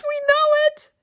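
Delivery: very high playful squeak on "We know it"; squeaky high voice. Real, not acted. Repeated high then plain.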